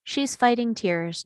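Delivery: usual speaking voice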